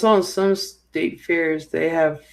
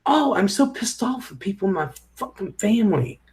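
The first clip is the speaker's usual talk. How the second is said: retold pissed-off rant; 7 semitones higher than usual. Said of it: "I'm like".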